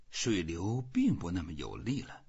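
usual speaking voice

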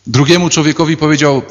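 normal talking voice

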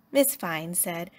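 ordinary delivery

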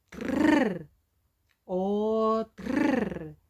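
тррр with a long rolled р; trilled trrr. Shown as a demonstration. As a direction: roll тррр, о, тррр. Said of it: "як це казати". (тррр з довгим розкотистим р)

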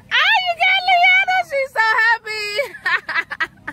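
very high, loud joyful shriek; excited holler. Real, not acted. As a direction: shriek with delight over the teacher. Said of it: "apologize for hollering in the previous clip".

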